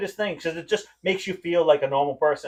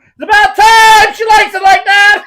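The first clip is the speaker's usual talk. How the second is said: high, loud, slow mock yell from next door; the sister yelling through the wall at the couple, a hammed-up shout; 15 dB louder than usual. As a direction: holler it like the sister in the next room. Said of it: "I heard next door".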